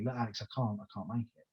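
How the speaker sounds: a hushed dreaded admission; sheepish confession. Retold or imitated. Partner telling Alex, hushed guilty apology. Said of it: "really dreaded telling me".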